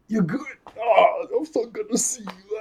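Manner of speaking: high-pitched hammed-up reunion; mock gushing relief. Best friends start to hug, mock gushing relief. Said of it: "they start to hug".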